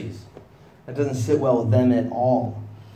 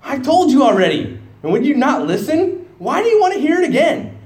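higher and louder, an exasperated comeback; snarky retort; 12 semitones higher, 7 dB louder than usual. Acted. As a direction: throw it back at them, fed up. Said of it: "he gets a little bit snarky here".

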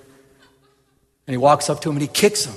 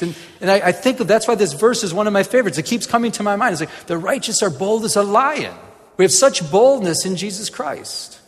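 higher, louder preaching build; preaching at full force; 6 semitones higher, 6 dB louder than usual. Acted. Preacher rousing listeners about bold lions, full preaching voice. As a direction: lift the congregation with it.

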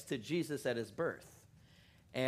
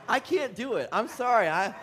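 laughing apology; 6 semitones higher, 6 dB louder than usual. Real, not acted. Laughing at his failed accent.

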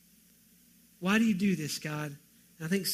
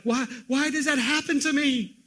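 mock complaining whine; 7 semitones higher, 5 dB louder than usual. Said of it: "Some of you are like".

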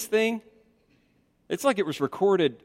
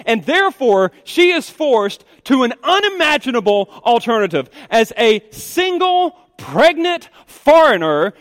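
high, driving, urgent sermon voice; impassioned preaching; 9 semitones higher, 6 dB louder than usual. Acted. Preacher pleading the plight of a pregnant foreigner, impassioned. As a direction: pile up the dangers with rising force.

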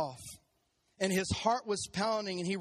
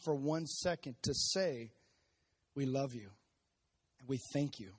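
closing prayer; 4 semitones lower than usual. Acted. Pastor leads closing prayer.

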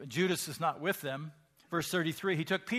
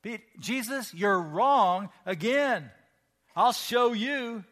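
cocky retort; 5 semitones higher, 7 dB louder, slower than usual. Acted. Peter voiced arguing with Jesus.

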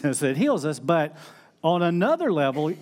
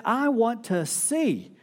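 pleading Bartimaeus; 7 semitones higher, slower than usual. Acted. Blind beggar calling out to Jesus, high and pleading. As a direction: call out to him like a blind beggar begging. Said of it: "he said".

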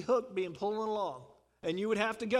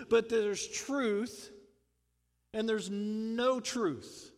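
slow emphatic contrast; much slower than usual. Acted.

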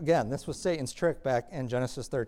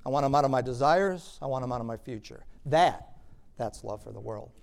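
usual speaking voice

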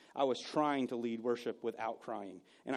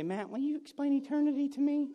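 higher eager questioner voice; mock congregant question; 11 semitones higher than usual. Churchgoer asking the pastor after service, higher and eager. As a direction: play a curious churchgoer. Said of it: "if you come up after church and say".

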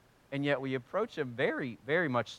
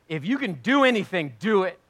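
raised, louder, pained demand; father pleading with Jesus, anguished and louder; agonized plea; 5 semitones higher, 6 dB louder than usual. Shown as a demonstration. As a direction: plead through real agony. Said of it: "That kind of tone".

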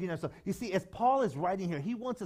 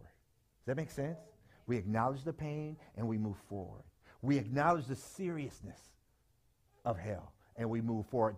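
ordinary delivery

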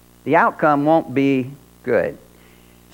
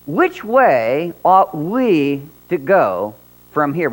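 Which way ought we to go from here, every word slowed and weighted; slow and emphatic; much slower than usual. Shown as a demonstration. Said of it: "let me ask you this".

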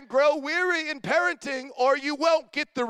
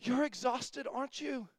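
much softer and slower, coaxing; soothing comfort; 5 dB quieter than usual. Retold or imitated. Imagined friend soothes the worn-out pastor, soft and slow. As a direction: coo it like comforting a tired friend. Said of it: "looking for someone to say".

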